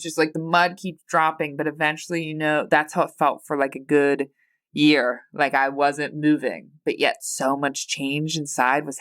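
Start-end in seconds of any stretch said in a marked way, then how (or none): none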